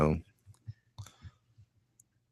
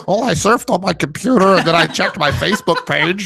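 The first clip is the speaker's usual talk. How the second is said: mocking kid voice; 11 semitones higher, 3 dB louder than usual. Mocking the lazy kid Mason.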